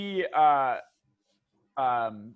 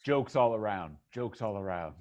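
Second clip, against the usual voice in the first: Ted; 7 semitones lower than usual. Acted. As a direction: murmur dry praise in a deep voice. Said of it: "uh, Ted says".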